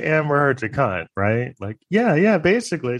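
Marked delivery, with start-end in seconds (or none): 1.9-3.0 high mock reply; lightly voiced expert, pitched high. A psychologist mocked in a high voice.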